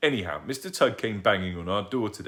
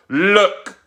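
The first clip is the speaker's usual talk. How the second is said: loud high outcry; cried out; 12 semitones higher, 14 dB louder than usual. Acted. Mister Tugg crying out at Dad over the chewed boots. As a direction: cry out holding up the evidence. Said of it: "cried Mister Tugg".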